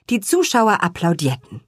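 ordinary delivery

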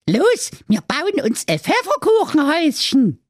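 very high cartoon pretzel voice; Brezel voice. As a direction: call it out in a tiny high character voice. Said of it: "Die Brezel rief".

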